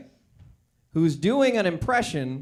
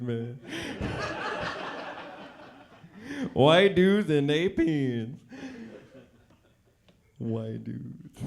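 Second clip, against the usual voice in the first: deliberately clumsy put-on street voice, lower, with drawn-out man; 3 semitones lower, slower than usual.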